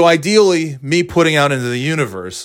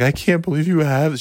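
mock teary fan; 6 semitones higher than usual. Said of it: "She was almost in tears".

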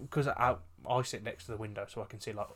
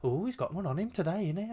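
higher-pitched mock teasing colleague; 8 semitones higher than usual. Colleague ribbing the busy worker, mock teasing. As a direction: imitate a colleague needling someone. Imitating a nosy chatty colleague.

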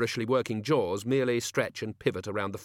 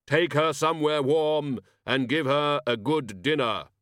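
slow, higher, imposing order; commanding; 4 semitones higher, 4 dB louder, slower than usual. A narrator's reading. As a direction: issue a weighty command, word by word. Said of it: "he commanded".

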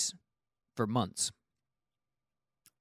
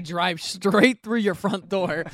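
laughing; 6 semitones higher, 5 dB louder than usual. Acted. Joking threat, laughing.

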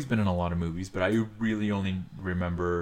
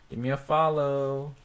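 higher, put-on come-on voice; mock promo voice; 8 semitones higher, slower than usual. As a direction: tease the followers like a promo.

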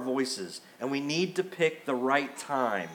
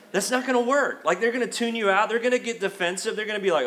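usual speaking voice

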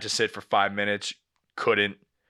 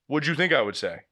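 usual speaking voice

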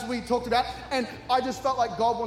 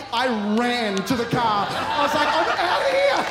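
high, loud escaping yell; panicked shout; 4 semitones higher, 8 dB louder than usual. Retold or imitated. Running to the car after praying, panicked shout. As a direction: shout it as you bolt.

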